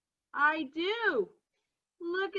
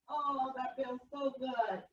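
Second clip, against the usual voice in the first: ordinary talk, nothing marked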